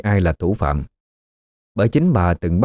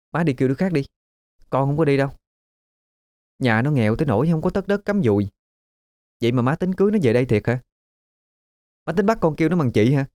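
put-on higher, fast, sneering young woman; scornful daughter; 7 semitones higher, faster than usual. A narrator's reading. Daughter refusing her mother, higher, scornful whine. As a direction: whine it back at mom with contempt. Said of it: "Nga hứ một tiếng".

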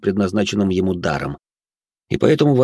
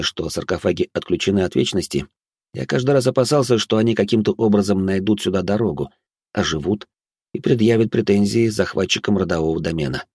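ordinary delivery, nothing marked

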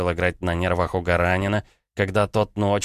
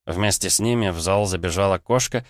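plain reading voice

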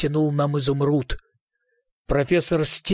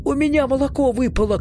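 fast, high-pitched cry of alarm; hurried, raised-pitch exclamation; 10 semitones higher, faster than usual. Acted. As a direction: blurt it out as a mild shout. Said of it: "крикнул я".

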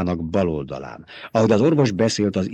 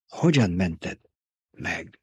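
faint whisper, fading; dying duke whispering a question; 2 semitones lower, 5 dB quieter, slower than usual. A narrator's reading. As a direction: whisper it weakly. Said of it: "suttogta Leto".

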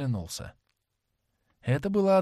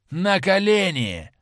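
slow, higher, louder command shout; 7 semitones higher, 6 dB louder, slower than usual. A narrator's reading. An avenger orders Dorian to kneel, shouting. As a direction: bark it out slowly, high and loud. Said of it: "закричал человек".

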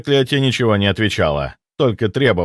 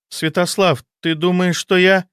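stunned woman; 8 semitones higher than usual. A narrator's reading. Anna realising.

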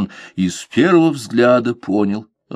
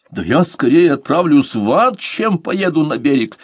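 raging; 7 semitones higher than usual. A narrator's reading. Lord refuses the captain's errand, raging. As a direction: storm out the refusal. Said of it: "продолжал бушевать Уэйд".

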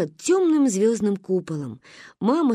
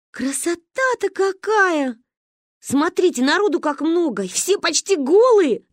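delighted; 10 semitones higher, 4 dB louder than usual. Acted. Karinka marvels at the crowded beach, high and thrilled. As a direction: squeal with joy at the beach. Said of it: "радовалась Каринка".

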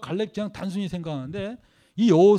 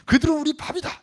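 much higher, confident and punchy; imitating bold faith; 8 semitones higher, 4 dB louder than usual. Preacher contrasting Caleb's faith, bold and confident. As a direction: throw it out with swagger. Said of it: "이거 하고 차이가 나요".

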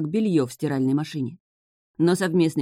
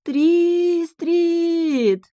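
high, long-drawn calling out; sudden cry; 14 semitones higher, 4 dB louder than usual. A narrator's reading. Witch calling to the sea king, sudden cry. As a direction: holler the name across the water. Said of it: "она вдруг закричала".

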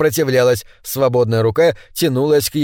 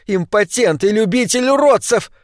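высоким чужим голосом, с яростью; выкрикни оскорбление визгливо; визгливая Паддинг; 8 semitones higher than usual. A narrator's reading. Паддинг продолжает визжать. (Паддинг оскорбляет мужа: высокий визгливый крик)

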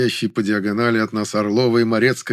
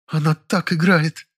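higher, drawn-out awed exclamation; breathed-out admiration; 6 semitones higher, slower than usual. A narrator's reading. Zina to the narrator, moved to tears by the acting, breathed-out admiration. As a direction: exhale it in slow, high wonder. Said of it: "выдохнула Зина".

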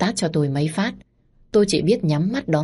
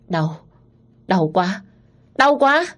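cry of pain; 3 semitones higher, slower than usual. Acted. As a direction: let the pain break out at the end. Young master in sudden pain.

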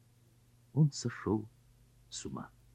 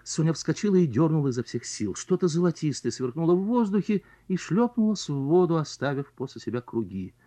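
usual speaking voice

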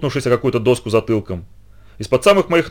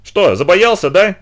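higher, louder taunt; ехидно; 6 semitones higher, 4 dB louder, slower than usual. A narrator's reading. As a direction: needle the friend. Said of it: "ехидно крикнула Литка". (ехидно поддразнивая)

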